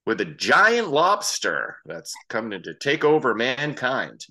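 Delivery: slow, drawn-out mock reading; first-time cold read. Imitating a man sight-reading an odd line, slow mock cold read. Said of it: "you can tell he's reading it for the first".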